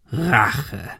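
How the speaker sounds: scornful villain echoing the word; derisive. A narrator's reading. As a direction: spit the word back mockingly. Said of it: "Der Mann lachte Ben aus". (höhnisch wiederholt)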